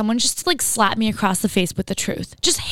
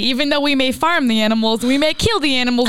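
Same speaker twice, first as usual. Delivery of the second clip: hammed-up southern family spokesperson; mock-earnest southern declaration; southern accent; 2 semitones higher than usual. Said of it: "let that slip, the accent".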